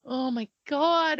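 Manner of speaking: ordinary talk, nothing marked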